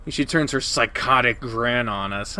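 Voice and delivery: usual speaking voice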